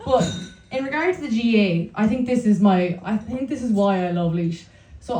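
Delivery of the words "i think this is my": ordinary delivery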